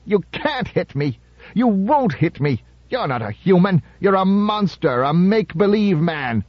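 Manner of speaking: high, manic jeering; wild laughter. A narrator's reading. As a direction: cackle through the taunts like a madman. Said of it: "The thin man was laughing wildly".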